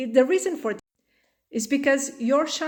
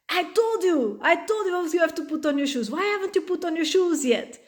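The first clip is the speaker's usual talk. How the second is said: higher, fast, sharp scolding; aggressive nagging; 6 semitones higher, faster than usual. Retold or imitated. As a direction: snap at the kid who won't put on shoes. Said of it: "aggressively being like".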